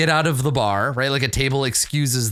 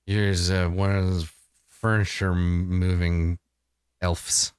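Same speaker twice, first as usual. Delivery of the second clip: musing onlooker; 7 semitones lower than usual. Acted. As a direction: muse aloud in a low voice, with pauses. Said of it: "He's like".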